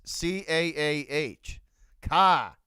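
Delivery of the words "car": punchy, loud Boston car, R dropped; Boston accent. Shown as a demonstration. Streamer correcting chat on saying car, loud Boston accent. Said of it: "You gotta say it right".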